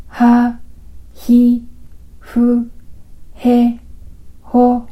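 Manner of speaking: ハ、ヒ、フ、ヘ、ホ said slowly, one at a time, with a pause after each; slow syllable demo. Shown as a demonstration. Teacher slowly models ハ ヒ フ ヘ ホ for learners to repeat. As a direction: say ハ ヒ フ ヘ ホ slowly and separately so each consonant is heard clearly. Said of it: "もう一度発音してみますよ".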